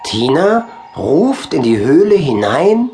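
ordinary delivery

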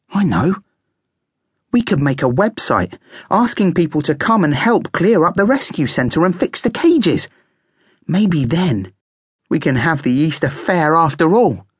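high-voiced little planner; keen guinea pig with an idea. A narrator's reading.

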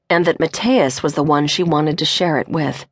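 ordinary delivery, nothing marked

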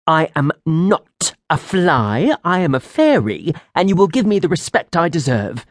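indignant fairy. Acted. Offended fairy demanding respect.

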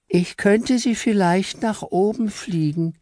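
very high, slow, gentle voice; Fräulein Engel hesitantly offering to fly her up; timid little angel. Acted.

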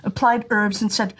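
plain reading voice

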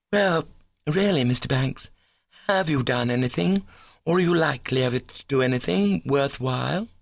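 high, prim questioning; high-minded girl demanding proof; ambitious young woman, challenging. Acted.

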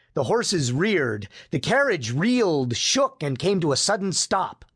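plain reading voice

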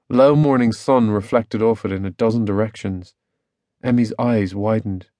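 usual speaking voice

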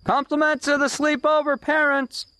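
high-pitched delivery call; caller's announcement. Acted. Caller bringing pizza from the parents, announcing.

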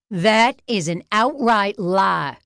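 high, slow, emphatic outrage; sputtering. A narrator's reading. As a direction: sputter indignantly, leaning on each word. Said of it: "Kicksmiller sputtered".

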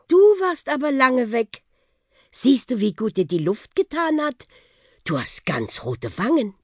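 a hint of a chatty aunt character; lightly voiced aunt. A narrator's reading. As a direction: welcome the boy back with fond surprise.

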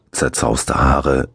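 usual speaking voice

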